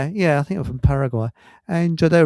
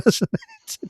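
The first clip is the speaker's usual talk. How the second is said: high laugh through the teasing question; laughing jibe. Retold or imitated. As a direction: tease him, laughing. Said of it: "One of the blokes said".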